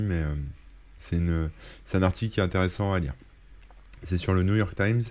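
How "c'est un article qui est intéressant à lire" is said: ordinary talk, nothing marked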